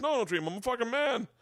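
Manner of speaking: high-pitched, scoffing mock dad; indignant dad. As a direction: protest as a dad offended by the question. Acting dad scoffing at dreaming.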